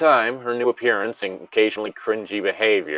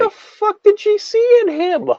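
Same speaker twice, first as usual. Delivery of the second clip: much higher and louder, baffled; incredulous outburst; 5 dB louder than usual. Real, not acted. Friend baffled by Miss Piggy's taste, loud incredulous outburst. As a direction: blurt it in disbelief. Baffled rant about Wolfbeard.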